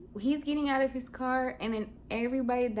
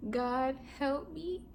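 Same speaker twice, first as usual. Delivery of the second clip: high, soft, helpless plea; pleading prayer; 6 semitones higher, 6 dB quieter than usual. Retold or imitated. Praying to God while he comes in, pleading softly. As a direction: pray under your breath, pitched up high. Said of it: "trying to just pray to God".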